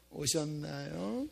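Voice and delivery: mimicked cool greeting, soft and drawn out; lukewarm greeting. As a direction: greet softly and slowly with reserved politeness. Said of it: "뜨겁지가 않아요". (미지근하게 늘여 묻는 인사 흉내)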